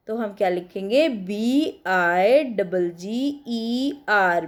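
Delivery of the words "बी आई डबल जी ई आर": letter by letter, slowly; slow spelling out. Shown as a demonstration. Teacher spelling fattest to students, slowly.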